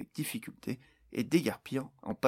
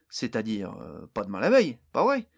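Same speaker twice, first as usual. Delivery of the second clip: mocking; 7 dB louder than usual. A narrator's reading. Barnes taunting the raging orc, mocking jab. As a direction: needle the orc with a grin. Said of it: "railla Barnes".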